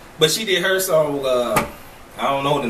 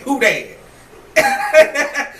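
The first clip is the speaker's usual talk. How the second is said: mock Southern accent; 6 semitones higher, 3 dB louder than usual. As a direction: mimic her Southern rap twang lightly. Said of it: "They don't say that in Australia".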